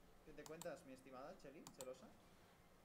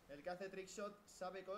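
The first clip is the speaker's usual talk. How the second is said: ordinary delivery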